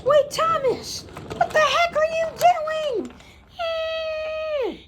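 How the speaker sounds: high, frantic yell; panicked yelling. Acted. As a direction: shriek at Thomas. Character sees Thomas crash.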